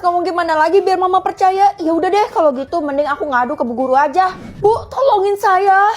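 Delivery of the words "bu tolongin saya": slow, drawn-out plea; pleading. Acted. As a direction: beg the teacher for help. Student begging teacher for help. (memohon dengan memelas, pelan)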